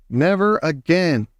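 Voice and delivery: ordinary delivery, nothing marked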